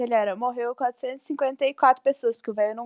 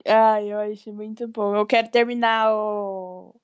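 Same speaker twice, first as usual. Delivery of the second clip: ordinary talk, nothing marked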